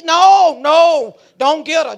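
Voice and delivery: loud, drawn-out no, no; urgent refusal. Retold or imitated. Scared man tells mama not to fetch her, urgent refusal. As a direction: stop them loudly and urgently.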